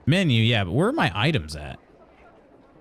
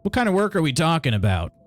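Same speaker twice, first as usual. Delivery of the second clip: ordinary delivery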